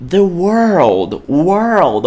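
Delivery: world with a clear R-L; World, said carefully; R-L world demo. Shown as a demonstration. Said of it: "olha a pronúncia".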